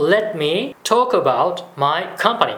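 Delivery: very slow, careful English demo; slow model phrase. Shown as a demonstration. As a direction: draw each word out for the learner. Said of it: "説明させてくださいっていう場合".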